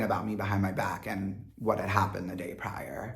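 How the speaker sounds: unvoiced secretive narration; whispering. Real, not acted. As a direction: whisper as if still hiding in the bunk. Spying on whispering bunkmates.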